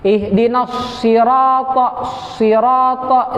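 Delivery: "sirata" with lips rounded so it sounds like sirato; "sirata" with a rounded, heavy ta ending in o; wrong rounded sirata. Shown as a demonstration. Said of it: "itu keliru".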